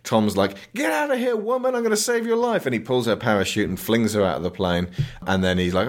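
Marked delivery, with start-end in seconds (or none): 0.8-2.7 very high, urgent parody of a hero; hammed-up rescue cry; mock frantic hero. Tom saving woman from the plane, mock frantic.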